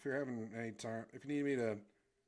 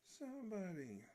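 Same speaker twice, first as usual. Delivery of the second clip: cartoon bystander calling; 11 semitones higher than usual. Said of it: "He's, like, pointing".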